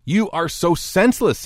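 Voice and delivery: high-pitched, fed-up rebuke; acted exasperation; exasperated. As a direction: scold as if patience has run out. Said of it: "Jesus can't take it anymore".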